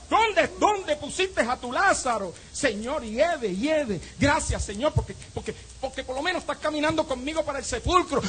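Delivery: high-pitched preaching plea, volume held back; impassioned pleading. Acted. Preacher addresses the Lord on the way to the tomb, pleading. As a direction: plead high, drawing out the opening question.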